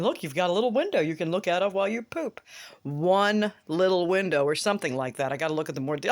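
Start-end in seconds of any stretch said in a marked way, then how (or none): none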